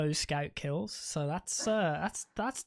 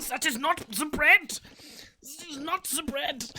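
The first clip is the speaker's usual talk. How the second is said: mock German accent; 8 semitones higher than usual. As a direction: rant like a furious German shopper. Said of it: "the Germans are there fucking pulling their hair out".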